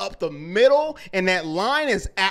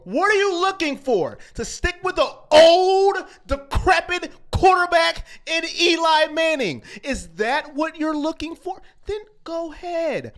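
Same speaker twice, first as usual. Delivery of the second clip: higher, louder heated rant that peaks in a shout; emotional rant; 8 semitones higher, 3 dB louder than usual. Real, not acted. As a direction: vent at the team, rising to a shout. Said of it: "Now I'm a little emotional".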